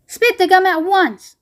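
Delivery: high, loud command; a father giving a firm order. A narrator's reading. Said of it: "ordered Mister Beauregard".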